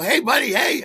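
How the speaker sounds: high, loud call across the lobby. Retold or imitated. Calling out to the Broadway star leaving after the show, loud. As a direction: hail him as he leaves.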